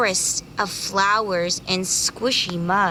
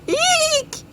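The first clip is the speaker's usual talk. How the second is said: very high squeak; frightened eek. Acted. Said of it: "he cried".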